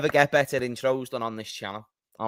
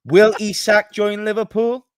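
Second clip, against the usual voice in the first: high, loud stream-opener impression; hammed-up, high-pitched host's teaser; Callum; 7 semitones higher, 8 dB louder than usual. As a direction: mimic a host posing big questions, pitched up. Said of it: "You do Callum".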